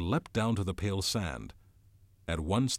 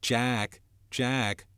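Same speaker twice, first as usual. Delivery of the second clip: mocking echo, raised in pitch; bitter mimicry; 5 semitones higher, 4 dB louder than usual. A narrator's reading. Jack throws Ralph's cry back, bitter mimicry. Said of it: "Jack's voice sounded in bitter mimicry".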